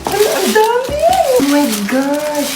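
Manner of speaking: high squeal easing into slow awe; squeal, then draw the words out; delighted amazement. Acted. Child shown many cheesecake flavors, delighted amazement.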